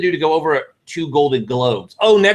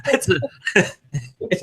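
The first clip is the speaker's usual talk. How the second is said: laughing through the words, slow; real laugh breaking the sentence; cracking up mid-sentence; 3 dB quieter, much slower than usual. Real, not acted. Laughing at the accent point.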